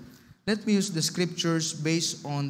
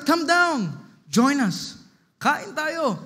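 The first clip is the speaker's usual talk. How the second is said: high, raised calling voice; 8 semitones higher, 5 dB louder, much slower than usual. Retold or imitated. Margaret invites the others down to eat, calling out. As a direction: call them down to join in.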